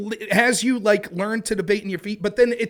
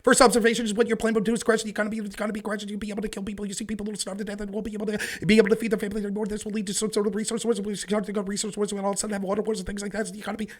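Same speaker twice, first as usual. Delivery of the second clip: very fast monotone debate delivery; policy debate speed-reading; 4 dB quieter, much faster than usual. Shown as a demonstration. Showing a podcast host how debaters talk, very fast. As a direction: rattle it off in a rapid, unbroken stream. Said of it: "that's how you talk and debate".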